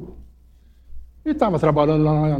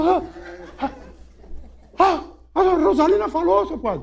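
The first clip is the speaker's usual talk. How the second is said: espanto do menino; 12 semitones higher than usual. Retold or imitated. Menino espantado com a mula.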